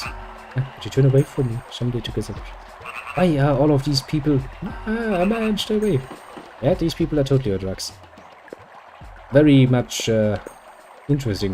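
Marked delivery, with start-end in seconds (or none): none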